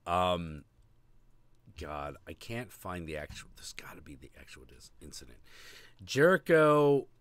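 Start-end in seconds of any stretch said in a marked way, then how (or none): 1.8-5.4 whispering. Real, not acted. Searching, muttering to himself.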